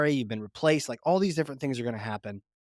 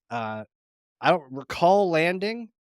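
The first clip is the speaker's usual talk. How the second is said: normal talking voice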